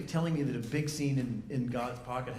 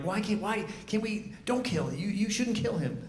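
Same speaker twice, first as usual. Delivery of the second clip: pleading audience; 7 semitones higher than usual. Retold or imitated. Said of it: "people were like".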